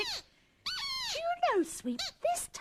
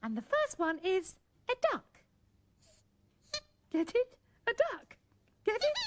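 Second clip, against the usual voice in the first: ordinary delivery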